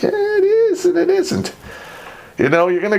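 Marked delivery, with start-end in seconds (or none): 0.0-1.5 much higher teasing voice; mock ominous. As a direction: tease him with mock mystery. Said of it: "And I'm like".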